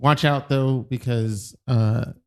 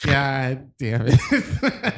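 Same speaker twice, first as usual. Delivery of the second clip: laughing; much slower than usual. Real, not acted. Embarrassed by the teasing.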